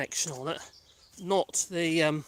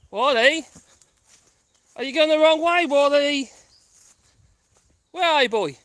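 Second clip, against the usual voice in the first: calling out; 7 semitones higher, 9 dB louder than usual. Real, not acted. Calling dog from distance.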